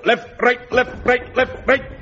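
barked marching cadence; drill calls. Acted. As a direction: call the march step.

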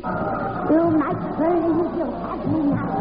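slow, soft menacing witch. Acted. Witch's voice returns in tale.

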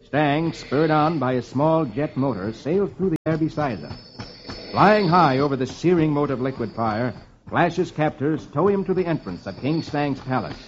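measured adventure-serial narration; story narration. A narrator's reading. Reader setting the scene on Venus, measured storytelling.

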